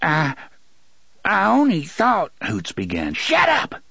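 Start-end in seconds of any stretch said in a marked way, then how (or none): 0.0-2.3 high, hesitant excuse; start, halt, then plead high; timid, raised stammering excuse; frightened faltering. A narrator's reading. Hoots falters in fear, cut off. 3.1-3.8 high, strained shout; sudden high-pitched shout. A narrator's reading. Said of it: "Gasher shouted".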